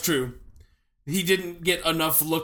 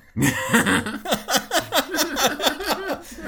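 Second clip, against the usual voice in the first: long, high peal of laughter; 7 semitones higher than usual. Real, not acted. Host cracking up at claw hand joke, long laugh. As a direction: crack up at length.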